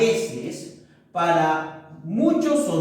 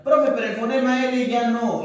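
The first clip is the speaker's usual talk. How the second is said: a pupil cutting in, higher and a touch louder; eager student voice; 5 semitones higher, 4 dB louder than usual. Acted. Pupil interrupting the teacher, higher and a touch louder. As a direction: jump in like a keen student.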